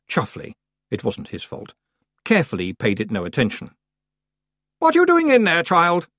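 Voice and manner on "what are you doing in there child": high, loud, peevish aunt. A narrator's reading. Aunt outside the locked door, calling in peevishly. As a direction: nag in a sharply raised pitch. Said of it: "said a muffled voice peevishly".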